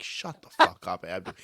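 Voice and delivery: low, restrained mock snap; imitated angry tone. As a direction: mimic a teammate's cursing irritation. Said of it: "the tone of your voice".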